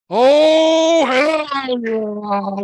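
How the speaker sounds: loud, high hell yeah shout; Stone Cold Steve Austin. Retold or imitated. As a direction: belt it like a wrestling promo. Stone Cold catchphrase impression.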